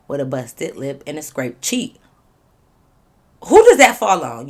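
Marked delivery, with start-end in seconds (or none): 3.5-4.5 louder, pressing question; loud question to viewers. Shown as a demonstration. Asking viewers who is to blame, loud and pressing. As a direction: demand an answer from viewers. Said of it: "Y'all answer".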